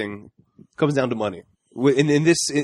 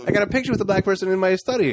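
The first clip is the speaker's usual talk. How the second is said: higher mock old man voice; mock defensive; 7 semitones higher than usual. Mocking Sterling.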